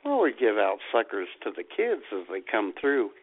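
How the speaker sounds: usual speaking voice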